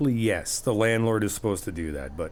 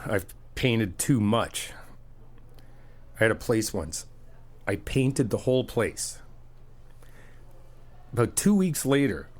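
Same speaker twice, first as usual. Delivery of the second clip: ordinary talk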